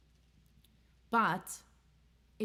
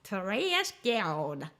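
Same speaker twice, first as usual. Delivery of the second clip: announcer voice; 4 semitones higher, 4 dB louder, much slower than usual. Acted. Podcast sign-off in put-on voice.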